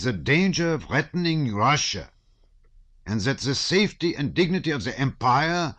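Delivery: put-on a stout German officer in a thick German accent. A narrator's reading. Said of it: "speaking with a German accent".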